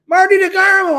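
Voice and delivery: a big joyful hail to a friend; real excited shout to a friend in the audience. Real, not acted.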